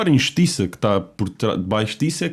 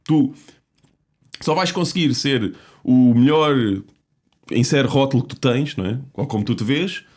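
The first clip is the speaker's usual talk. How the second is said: ordinary talk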